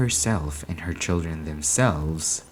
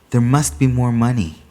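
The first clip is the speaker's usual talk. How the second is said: insistent whisper; 4 semitones higher, 7 dB louder than usual. A narrator's reading. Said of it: "It came whispering".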